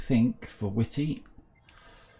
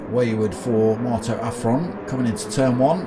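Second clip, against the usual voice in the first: motorsport commentator; 3 semitones higher than usual. Acted. Live sim race commentary.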